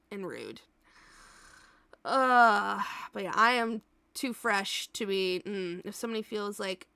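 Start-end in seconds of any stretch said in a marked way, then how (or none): none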